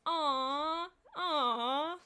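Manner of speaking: sympathetic crowd aww; audience aww. Acted. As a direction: coo like a studio audience.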